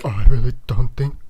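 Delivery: loud, put-on strong accent; Eddie Redmayne. Imitating a film's main character for friends, weird accent. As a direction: impersonate a weird, awkward movie character. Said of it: "Please do your best impersonation".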